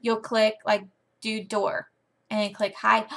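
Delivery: ordinary delivery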